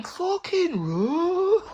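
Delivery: drawn-out high shout to flee; urgent yell. Retold or imitated. Mate shouting at him to run, high drawn-out yell. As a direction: stretch it out, high and loud, a panicked warning. Mate yelling warning retold.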